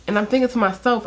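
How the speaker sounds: usual speaking voice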